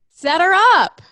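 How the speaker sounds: loud, high call that falls at the end; eager exclamation. Real, not acted. Friend pushing for a celebrity introduction, eager exclamation.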